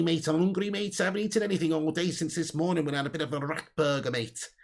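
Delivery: put-on Scouse patter; Scouse accent. Streamer doing Stevie Carragher for his viewers, Scouse accent. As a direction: play a chatty Scouser cadging favours. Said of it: "More Scouse accent, says Fergie".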